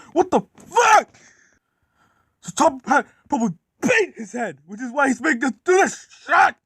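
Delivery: high-pitched screamed curse, then heated yelling; enraged rant. Acted. Gordon raging at the boss's orders, furious high rant. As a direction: rant at the top of the voice. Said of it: "He was extremely fucking mad".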